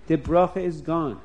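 ordinary delivery

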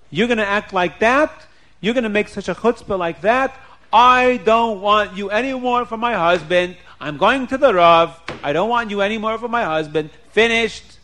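acted outrage, emphatic and final; angry ultimatum. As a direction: lay down the law, loud and final. Wife threatens divorce.

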